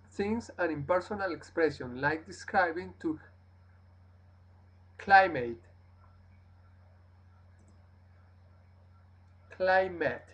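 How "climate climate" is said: Climate ending in a short "mat", not "mate"; Climate, stressed first syllable, relaxed "mat"; model pronunciation. Shown as a demonstration. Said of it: "Mat, mat".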